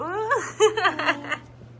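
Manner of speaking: high giggling laugh; delighted laugh. Real, not acted. Mother delighted by her cooing baby, high giggly laugh.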